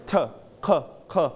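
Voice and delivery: "T-", then "k-" twice, each alone; sounds in isolation. Shown as a demonstration. Teacher breaks turquoise into sounds, "T-" and "k-" each said alone. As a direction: sound out "T-" and "k-" separately. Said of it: "ตัว Q ออกเสียง k".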